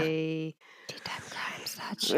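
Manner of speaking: hushed whisper of the shop name; whispered plug. Pretend. Plugging the shop to listeners, soft whisper. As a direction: whisper the web address like a secret.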